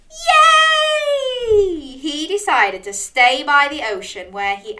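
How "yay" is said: high, loud, drawn-out yay; gasp, then whoop with delight; big cheer. Acted. Storyteller cheers Dog's decision, jubilant.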